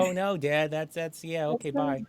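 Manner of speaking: a hint of a kid badly faking regret; mock disappointment. Acting kids' feigned disappointment on phone.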